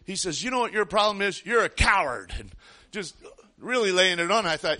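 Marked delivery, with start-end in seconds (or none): 0.4-2.3 higher, louder mock taunt; verbal attack. Stranger taunting the hunter as a coward, louder and higher. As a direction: jab it like a sneering bully. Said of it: "he starts verbally attacking me".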